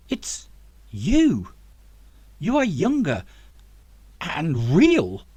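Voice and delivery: can't believe who he's seeing; astonished recognition. Acted. Zack to the younger professor, loud and amazed.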